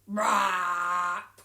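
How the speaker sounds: big belchy burp noise; loud comic burp; loud fake burp. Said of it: "Hare burped big burps".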